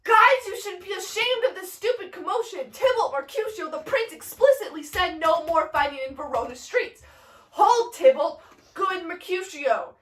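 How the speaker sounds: high, urgent stagey call; Romeo yelling over. Acted. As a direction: call across a brawl to break it up. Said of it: "yells over, he goes".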